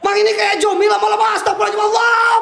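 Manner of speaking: high, frantic cry for help; panicked scream. Real, not acted. Ghost hunter calling Mang as it approaches, panicked scream. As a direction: scream out a prayer in rising panic.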